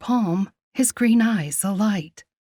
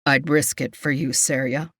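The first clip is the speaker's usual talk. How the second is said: low, hoarse man's voice; hoarse devotion; 5 semitones lower than usual. A narrator's reading. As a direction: pledge it hoarsely, pitched down. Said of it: "He said hoarsely".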